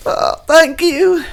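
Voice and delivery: high, slow timid thanks; a small, high, dazed thank you; high shaky-free meek thanks; meek brother. Acted. JP accepting water.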